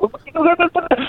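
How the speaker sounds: high, squeaky mock confusion; baffled what. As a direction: act the robbers' dumbfounded panic. Robbers baffled by locked door.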